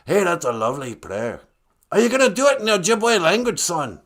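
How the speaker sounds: gruff pirate, approving, rising high and loud; Gitche Manadu. Acted. Creator asking about the prayer in Ojibwe, gruff pirate voice. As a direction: ask like a hearty pirate. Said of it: "kinda sounds like a pirate".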